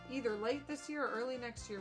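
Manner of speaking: usual speaking voice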